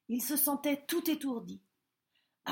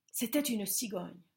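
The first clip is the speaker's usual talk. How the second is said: ordinary delivery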